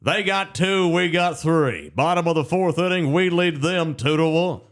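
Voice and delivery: high, loud play-by-play with a partisan edge; homer radio announcer. Retold or imitated. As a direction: call it like the home team's biggest fan. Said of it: "He never said Sam Houston".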